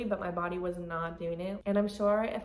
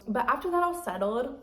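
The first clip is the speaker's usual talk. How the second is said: ordinary talk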